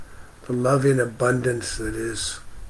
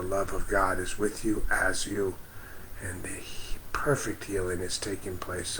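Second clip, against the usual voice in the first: soft unvoiced whisper, softer and lower; whispered prayer; 4 semitones lower, 8 dB quieter than usual. Real, not acted. Praying over a grieving viewer, hushed whisper. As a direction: breathe the blessing out in a hush.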